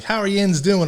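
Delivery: put-on country Southern accent, jokingly overdone; mock Southern accent. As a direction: tease it in an exaggerated Southern accent. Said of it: "It's not like Mississippi".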